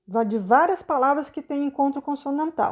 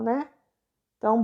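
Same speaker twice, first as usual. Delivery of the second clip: normal talking voice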